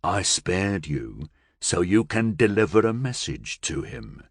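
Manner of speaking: low, dark menacing voice; a cold threat from a captor; possessed man to Billy Joe, low and menacing. Acted.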